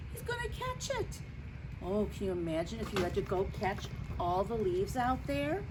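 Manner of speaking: usual speaking voice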